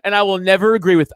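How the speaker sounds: ordinary delivery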